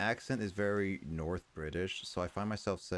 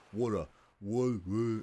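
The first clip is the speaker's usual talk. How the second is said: louder "Water", Aussie attempt in the speaker's own accent; forced Aussie accent; 5 semitones higher, 5 dB louder than usual. Shown as a demonstration. Learner still forcing the Australian accent on "Water". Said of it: "still forcing it with my own accent".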